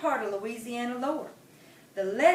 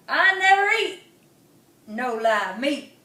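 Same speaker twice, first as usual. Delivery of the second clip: squawking buzzard; 3 semitones higher, 8 dB louder than usual. Acted. Buzzard refusing live meat, squawking. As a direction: squawk it with disgust. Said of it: "it squawked".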